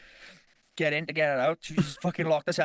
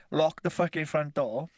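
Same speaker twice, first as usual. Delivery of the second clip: usual speaking voice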